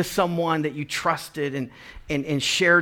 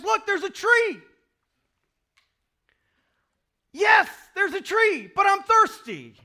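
mock dialogue. God and people dialogue voiced.

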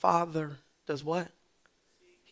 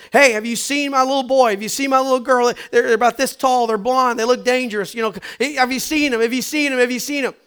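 frantic parent calling; 7 semitones higher, 10 dB louder than usual. Retold or imitated. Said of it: "You're shouting".

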